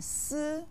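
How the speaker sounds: Si held level in pitch; first tone. Shown as a demonstration. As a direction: keep Si steady without rise or fall. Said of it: "with the first tone".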